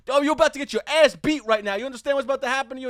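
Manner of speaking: imitated high, loud, rapid threatening; angry threat. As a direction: act out a drunk man threatening a fight. Acted threat of a beating.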